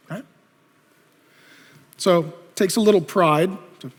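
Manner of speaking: ordinary talk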